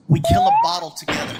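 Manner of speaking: usual speaking voice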